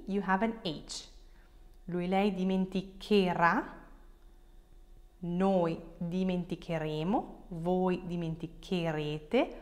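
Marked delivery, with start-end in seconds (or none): none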